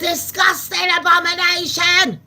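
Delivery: high, loud mock South African outrage; hammed-up South African disgust; South African accent. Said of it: "I do the South African talk".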